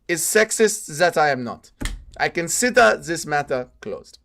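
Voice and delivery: put-on Russian-accented woman, modelled on a fighter; Shyla. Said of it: "It's gotta be, like, a bit Valentina Shevchenko".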